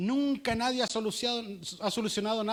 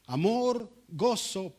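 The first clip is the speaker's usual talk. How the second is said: ordinary talk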